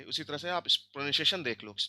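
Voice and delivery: ordinary talk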